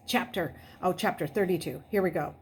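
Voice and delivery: usual speaking voice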